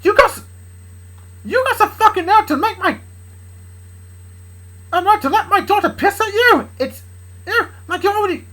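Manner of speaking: high, strained, stumbling outrage; angry rant. Acted. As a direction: rage at your daughter's lover in a high voice. Dad rages at Charlie.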